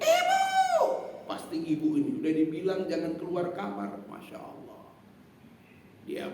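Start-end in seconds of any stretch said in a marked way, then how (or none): 0.0-0.8 a shrill high scream of Ibu; daughter's shriek. Retold or imitated. Daughter screaming for her mother over the smell. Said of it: "Si anak teriak". 4.0-4.8 hushed masya allah. Real, not acted. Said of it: "preacher's exclamation".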